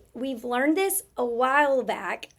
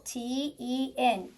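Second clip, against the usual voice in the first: usual speaking voice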